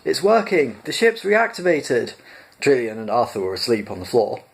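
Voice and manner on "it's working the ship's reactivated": high, eager announcement; thrilled cry that the ship works; excited shout. A narrator's reading. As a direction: Ford calling out the good news. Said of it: "he shouted".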